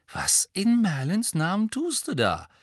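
high, incredulous question; baffled. A narrator's reading. Ron watching Hermine lay out hats, baffled. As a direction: question her sanity, pitch swooping up. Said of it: "als würde er sich Sorgen um ihren Geisteszustand machen".

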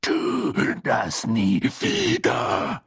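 very slow, raspy, high-pitched snarl; drawn-out, punched warning; growled warning. A narrator's reading. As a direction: hammer out each word separately with a growl. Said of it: "bei jedem Wort schlug das Frettchen".

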